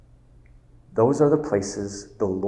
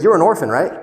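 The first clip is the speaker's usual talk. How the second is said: loud, higher pointed question; sharp challenge; 7 semitones higher, 7 dB louder, faster than usual. Retold or imitated. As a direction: fire the question at him. Therapist confronts Will.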